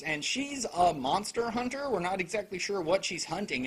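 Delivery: ordinary talk, nothing marked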